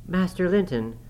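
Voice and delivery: high, urgent call; crying out. A narrator's reading. Imprisoned nurse demanding answers from the boy, high urgent cry. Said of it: "I cried".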